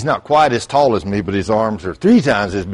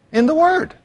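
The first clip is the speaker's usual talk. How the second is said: In the Word shot up high; high exclamation. Real, not acted. Preacher calls out the answer to his church. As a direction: sing out the Word.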